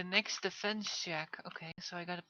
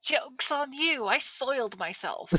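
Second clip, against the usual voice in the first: slow, drawn-out psycho taunt, starting high and loud; yelling psycho; 6 semitones higher, 8 dB louder, slower than usual. Acted. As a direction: crow it like a mad raider, stretching the words. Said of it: "hearing the psychos yell all kind of random".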